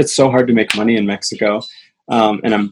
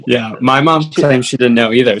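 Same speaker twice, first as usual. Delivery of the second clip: ordinary talk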